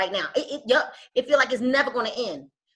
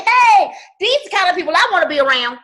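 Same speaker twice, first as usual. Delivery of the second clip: bright, much higher, loud burst of welcome; excited greeting; 6 semitones higher, 8 dB louder than usual. Retold or imitated. Coach showing the eager attitude she wants, excited. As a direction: greet the crowd with big excitement. Excited caller joining a packed call.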